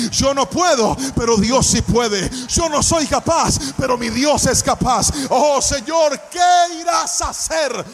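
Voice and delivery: loud, impassioned sermon building to a shout; fervent preacher rallying the congregation; full-force preaching. Acted. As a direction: preach it to the back row, rising in fervor.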